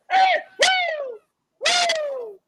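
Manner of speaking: high whooping shout; a sudden high woo; mock cheer. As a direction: whoop like a hyped fan.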